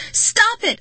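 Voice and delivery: very high, loud scream; furious, frightened outburst. A narrator's reading. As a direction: scream it at the top of your pitch. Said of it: "I screamed".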